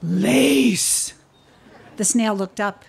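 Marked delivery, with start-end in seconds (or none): 0.0-1.1 one huge word from a big beast; a hint of a giant hippo; booming hippo. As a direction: bellow it like a hippopotamus. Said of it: "one huge word".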